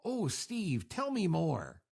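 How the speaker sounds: drawn-out sarcasm with falling tune; mock fascination, each phrase sliding down; sarcastic. Shown as a demonstration. Host demonstrating the contest phrase for listeners, sarcastic. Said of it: "let's do the sarcasm".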